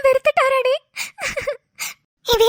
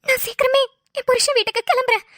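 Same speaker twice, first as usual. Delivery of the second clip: usual speaking voice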